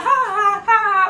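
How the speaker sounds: high, drawn-out mocking "ha". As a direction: imitate a rival's taunting fake laugh. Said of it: "doing the little [name] on me".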